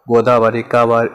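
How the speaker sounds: ordinary delivery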